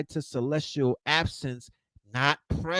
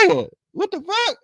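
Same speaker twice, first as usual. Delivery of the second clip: mock disbelief, pitched way up; high incredulous yelp; 13 semitones higher, faster than usual. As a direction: squeak it out in stunned outrage. Retelling his outrage.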